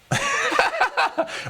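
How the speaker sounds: a burst of high-pitched laughter. Real, not acted. Laughs at own cheering.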